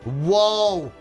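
ordinary delivery

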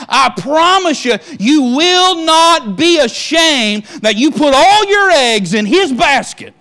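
loud, high, ringing climax; preacher building to the closing climax, full-voiced; preaching at full force. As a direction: preach it out to the congregation.

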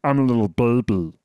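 put-on baby voice. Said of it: "it's kinda that baby voice".

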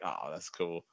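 low, soft, deflated mumble; quiet mumble. Retold or imitated. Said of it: "And I was like".

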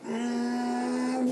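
long, high sustained hum; imitated droning sound. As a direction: drone it like something coming from the sky. Imitates sound coming from sky.

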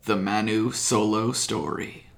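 ordinary talk, nothing marked

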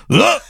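short high choked "ugh"; acted gag of being stabbed; stabbed-throat grunt. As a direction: let out a sudden mock death grunt. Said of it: "like that".

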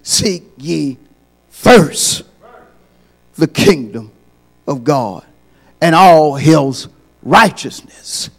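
slow, weighty reading of the verse; solemn scripture reading. Acted. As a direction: read the verse with reverence.

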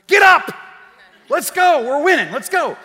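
put-on shouted urging at ten years old; kid's shout. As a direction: yell him back onto his feet. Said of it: "and I say".

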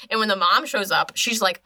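plain reading voice

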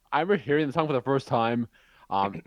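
ordinary talk

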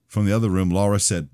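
ordinary delivery

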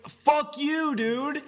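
high, loud angry retort; furious at the pizza clerk, he yells back; angry outburst. Retold or imitated. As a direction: snap it at the clerk like you've had enough. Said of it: "Oh, so mad".

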